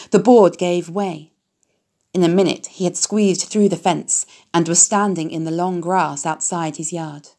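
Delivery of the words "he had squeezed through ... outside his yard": usual speaking voice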